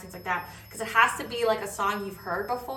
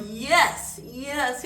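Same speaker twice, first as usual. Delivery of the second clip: lightly imitated, raised emphatic agreement; imitated approving woman; 6 semitones higher, 6 dB louder than usual. Overhearing woman approving the mother's speech, lightly imitated. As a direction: mimic an eavesdropper heartily agreeing. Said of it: "overheard my mom was like".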